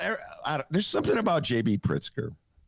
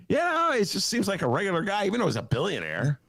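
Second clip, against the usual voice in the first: ordinary talk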